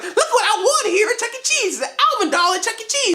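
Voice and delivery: high squeaky chipmunk, fast and giggly; Alvin chipmunk voice. Retold or imitated. Reviewer voicing Alvin showing off his prize, high and squeaky. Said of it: "Alvin walks up like".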